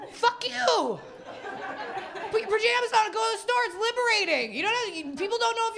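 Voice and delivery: high, fast and brash; playful high rant. Real, not acted. Telling audience off.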